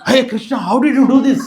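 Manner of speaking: high, loud startled question; astonished outcry. Retold or imitated. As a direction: blurt it out in amazement. Astonished gopi confronts Krishna.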